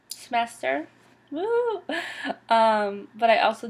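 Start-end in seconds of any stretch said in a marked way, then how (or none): 1.3-1.8 high, loud little woo; celebratory whoop. Real, not acted. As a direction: let out a quick high cheer. Cheers own coming graduation.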